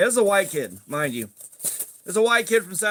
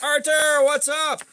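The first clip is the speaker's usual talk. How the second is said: higher, louder cheerful hello; greeting a viewer; 6 semitones higher, 3 dB louder, slower than usual. Real, not acted. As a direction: call out a friendly greeting.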